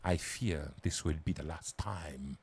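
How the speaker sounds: put-on a villain's slow European-accented greeting; generic European accent. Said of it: "in a generic European accent".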